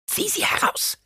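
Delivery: very high, whispery crone hiss; hissed command. A narrator's reading. Reverend mother orders the hesitant boy, hissing sharply. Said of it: "zischte die ehrwürdige Mutter".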